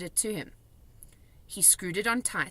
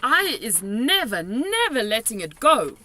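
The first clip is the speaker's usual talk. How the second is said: determined cry; 4 semitones higher, 8 dB louder than usual. A narrator's reading. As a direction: vow it fiercely. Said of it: "the B F G cried".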